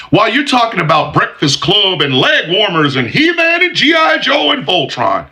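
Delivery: mock their nostalgia, yelling it; mocking rant. Real, not acted. Ranting at Gen Xers, building to a mocking shout.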